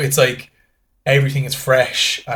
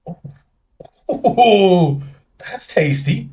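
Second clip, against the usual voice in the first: high, louder delighted Oh, oh; surprised wine novice; pleasantly surprised; 7 semitones higher, 4 dB louder, much slower than usual. Retold or imitated. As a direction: taste it and be won over.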